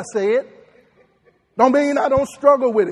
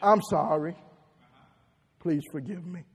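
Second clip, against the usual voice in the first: softer, falling Please forgive me; soft sincere apology; 5 semitones lower, 6 dB quieter than usual. Shown as a demonstration. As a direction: say it gently, like you mean it. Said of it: "it's in what you say".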